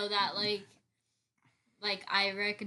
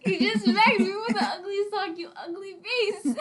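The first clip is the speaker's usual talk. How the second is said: higher and louder, laughing through it; laughing protest; 10 semitones higher, 8 dB louder than usual. Real, not acted. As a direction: cry out laughing after a smack. Laughing protest after being hit.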